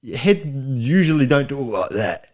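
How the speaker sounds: high, slow put-on Australian accent; mock Australian villain accent. Arguing locals don't talk so, slow mock Australian villain accent. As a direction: drawl like a fake Australian movie villain. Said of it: "do a voice like this".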